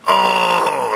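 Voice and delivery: long straining groan; imitated manly grunt. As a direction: mimic a man grunting on the toilet. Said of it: "like a fucking grunt".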